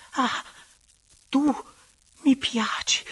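high, airy outburst with pauses; halting, rising passion; ardent confession. Acted. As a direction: give in and let the words escape, high and breathless. Lover gives in to his urging.

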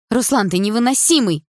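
high, exasperated outcry; cried out angrily. A narrator's reading. Sister fed up with her brother, crying out angrily. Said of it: "выкрикнула она".

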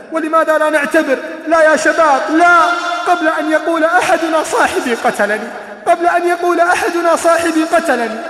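preacher pleading with youth, full force; fiery preaching. Acted. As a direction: hammer the warning home.

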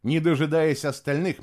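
ordinary delivery, nothing marked

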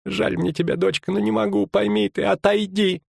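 high-pitched, apologetic plea; worried innkeeper refusing to help; anxious pleading. Acted. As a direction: beg her to understand, keep it high. Frightened innkeeper refuses her plea.

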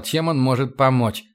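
ordinary delivery

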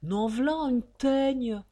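loud, drawn-out grumble; grumbling. Acted. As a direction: grumble with slow exasperation. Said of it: "ronchonna l'Oxico".

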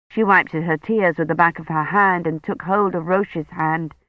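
ordinary delivery, nothing marked

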